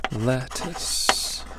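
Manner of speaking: soft, drawn-out lettuce; murmured to self. Shown as a demonstration. Teacher sounding it out while writing, soft. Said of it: "I'll try to write it".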